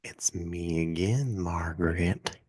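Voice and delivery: slow, drawn-out joke caller voice; slow spooky put-on voice. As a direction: put on a slow eerie stranger voice. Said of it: "No, I'm just kidding".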